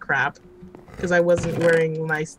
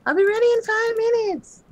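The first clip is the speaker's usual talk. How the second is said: high, sing-song prissy lady; mocking lady voice; 4 dB louder than usual. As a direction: mimic a fussy lady getting ready. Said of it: "you're like a lady".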